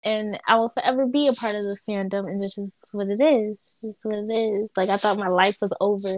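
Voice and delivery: ordinary delivery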